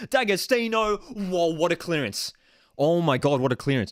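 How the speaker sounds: freaking out. Real, not acted. Watchalong host reacting to a clearance off the crossbar, freaking out. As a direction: call the near miss in loud disbelief. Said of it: "did you see the way I freaked out".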